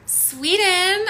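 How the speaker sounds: high and loud, rising at the end; excited greeting. Real, not acted. Teacher greeting a viewer from Sweden, excitedly. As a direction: call it out with delight.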